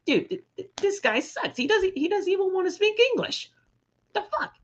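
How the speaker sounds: high-pitched imitation of griping fans; mock complaining fans. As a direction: put on a high voice for an indignant heckler. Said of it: "they're gonna be like".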